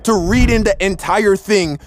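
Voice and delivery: high-pitched, worked-up disbelief; animated, high storytelling of a shock twist; incredulous reveal. Real, not acted. Incredulous reveal of the empty cartridges.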